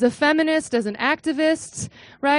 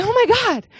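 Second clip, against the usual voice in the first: excited realization; 9 semitones higher, 3 dB louder than usual. Retold or imitated. Sudden realization at ritual.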